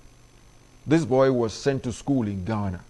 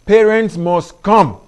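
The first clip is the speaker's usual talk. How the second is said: "must" hit louder and higher, drawn out slowly; stress on must; 5 semitones higher, 9 dB louder, slower than usual. Shown as a demonstration. Pastor telling parents PTA attendance matters, "must" stressed loud and slow. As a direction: lean hard on "must", take it slow. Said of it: "I emphasize the word must".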